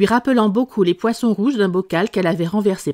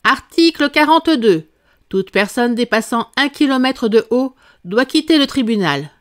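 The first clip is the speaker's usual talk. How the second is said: raised, loud proclamation easing as it goes; reading out loud; 5 semitones higher, 4 dB louder than usual. A narrator's reading. King reading a rule to court, proclaiming. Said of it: "se mit à lire à haute voix".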